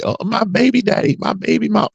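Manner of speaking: high mocking singsong of proud parents. Mocking people who boast of baby daddies, high sneer. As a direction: lift it up and mock them.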